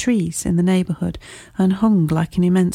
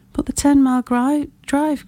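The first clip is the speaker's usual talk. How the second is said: higher-pitched, startled objection; gasped protest; 7 semitones higher than usual. A narrator's reading. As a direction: object in a high, gasping exclamation. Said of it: "grasped Hatherley".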